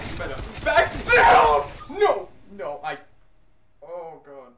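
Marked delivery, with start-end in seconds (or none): 0.1-2.3 loud, high panicked yell; terrified yell. Real, not acted. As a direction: scream at the monster. Yelling at monster.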